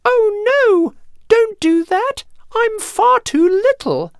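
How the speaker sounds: frightened small goat squeal; tiny goat pleading. A narrator's reading. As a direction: plead in a tiny high-pitched voice. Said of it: "in his tiny voice".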